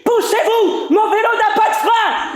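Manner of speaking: high, loud yell to clear the way; shouting warning. Acted. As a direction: shout at the pedestrians to get out of the way. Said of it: "Je crie".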